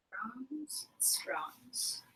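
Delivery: unvoiced breathy whisper. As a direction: whisper it like a secret. Whispered word.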